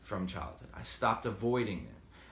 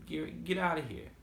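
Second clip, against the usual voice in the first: Boston accent; 7 semitones higher than usual. Shown as a demonstration. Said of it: "I got a little bit of a Boston accent".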